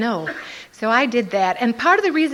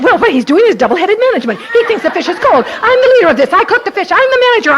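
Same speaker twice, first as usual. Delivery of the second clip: high loud crowing; high loud victorious inner voice; triumphant self-assertion; 9 semitones higher, 10 dB louder, much faster than usual. Retold or imitated. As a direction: crow it like you've won.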